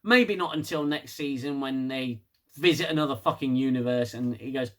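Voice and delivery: ordinary delivery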